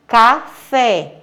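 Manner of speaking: Café split into CA and a clear FÉ; stress on final syllable. Shown as a demonstration. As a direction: separate the syllables of Café, holding FÉ. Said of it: "fé é a última sílaba".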